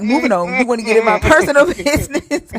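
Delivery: loud imitation dissolving into laughter; laughing mock brush-off. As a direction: mimic the dismissal while cracking up. Retelling his brush-off while cracking up.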